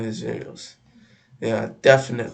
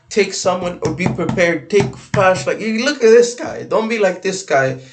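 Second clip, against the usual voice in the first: raised, higher-pitched urging; stressing advice in a raised voice; emphatic warning; 6 semitones higher, 4 dB louder than usual. Real, not acted. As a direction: warn them insistently, pitch climbing. Urgent advice to viewers.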